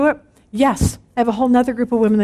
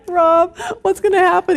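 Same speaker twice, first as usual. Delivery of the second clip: mock panicked plea; 6 semitones higher than usual. Panicking to husband in bed.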